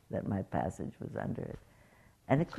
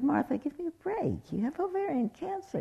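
much higher, incredulous give-me-a-break tone; exasperated disbelief. Retold or imitated. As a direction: scold a friend lovingly, pitch jumping up. Teller exasperated with her friend.

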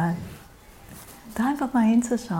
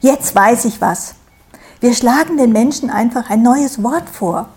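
proud; 3 semitones higher, 7 dB louder than usual. A narrator's reading. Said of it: "voller Stolz".

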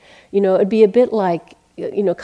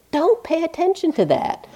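teacher's retort; 10 semitones higher than usual. Retold or imitated. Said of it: "And he said".

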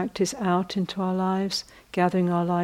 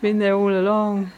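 laughing; 3 semitones higher than usual. Real, not acted. Laughing after punchline.

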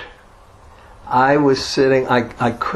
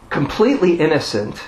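ordinary delivery

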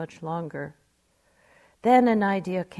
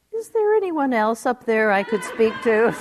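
louder, hopeful asking for someone else; punchline quip; 4 semitones higher, 8 dB louder than usual. Retold or imitated. Woman on the cliff asks for someone else to speak to. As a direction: deliver the punchline with mock hope. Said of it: "said the woman".

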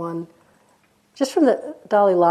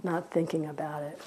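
hushed, much softer, letting the words settle; near-silent meditation cue; soft guiding murmur; 4 semitones lower, 14 dB quieter than usual. Real, not acted. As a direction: guide them gently into stillness, very softly. Quiet guiding, settling into awareness.

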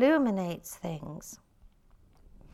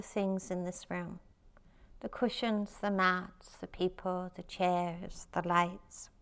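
guided meditation. Acted. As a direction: lead the room gently into stillness.

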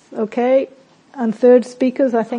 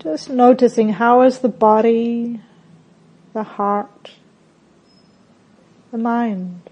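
calm meditation voice with long pauses; unhurried, settled guidance, softening at phrase ends; guided meditation. Real, not acted. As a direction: guide them gently inward, phrase by phrase.

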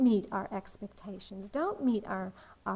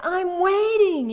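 high, loud, drawn-out mock impatience; Calvin frustrated; 8 semitones higher, 10 dB louder, slower than usual. Cartoon kid waiting on the sky, frustrated mock call. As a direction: sing out the wait in pretend frustration. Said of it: "he's getting really frustrated".

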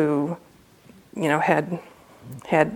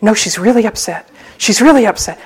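louder, urgent mock-panicked report; freaked-out receptionist; 4 semitones higher, 8 dB louder, faster than usual. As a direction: imitate a flustered warning, pushing each phrase. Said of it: "the receptionist is totally freaked out".

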